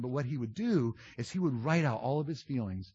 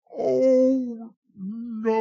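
long, high, drawn-out wail of dismay; hammed-up dawning dread, held on each word; mock horror; 11 semitones higher, 10 dB louder than usual. Replaying the moment after hitting send by mistake, hammed-up horror. Realizes he sent the angry email.

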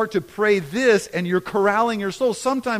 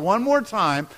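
usual speaking voice

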